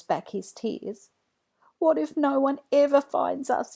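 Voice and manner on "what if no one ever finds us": put-on worried boy. A narrator's reading. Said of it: "he worries".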